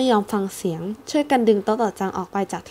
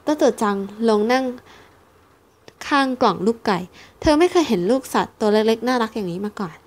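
usual speaking voice

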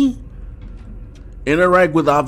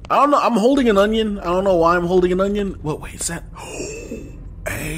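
usual speaking voice

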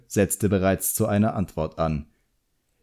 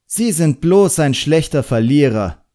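higher, louder defiant retort; defiant boy answering a sneer, louder; angry retort; 7 semitones higher, 7 dB louder than usual. A narrator's reading. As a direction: snap back at the examiner.

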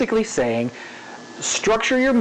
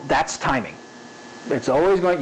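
ordinary delivery

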